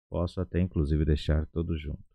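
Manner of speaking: usual speaking voice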